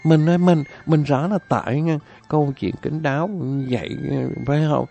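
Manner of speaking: high, wavering wife's voice; fretting wife. Acted. Wife questions husband why he is sad, high pleading. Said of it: "vợ nó mới nói".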